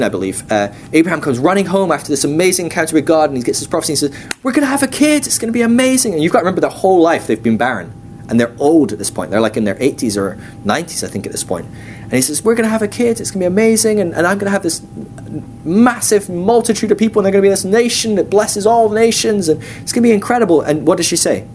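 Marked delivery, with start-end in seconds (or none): 4.4-6.1 high-pitched, thrilled announcement; Abraham, excited. Retold or imitated. Husband running home to his wife after the prophecy, excited. 12.4-20.6 high, gushing run of big promises; Abraham, excited. Retold or imitated. Husband telling his barren wife of the promise, excited.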